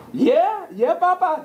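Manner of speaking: high, eager encouragement; excited yeah papa. Retold or imitated. Parent cheering on an eight-year-old son's plan. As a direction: hype up your son. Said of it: "I was like".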